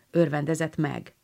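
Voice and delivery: plain reading voice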